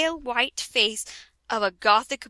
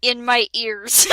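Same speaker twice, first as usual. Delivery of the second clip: normal talking voice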